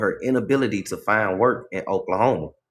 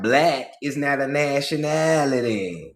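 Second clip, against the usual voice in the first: put-on Southern accent; 3 semitones higher than usual. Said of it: "Real Southern".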